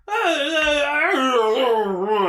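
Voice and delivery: high, loud wavering ah sounds like a warped filter; distorted wobbly ahs. Retold or imitated. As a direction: mimic a voice melting through a distortion filter. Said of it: "they're like".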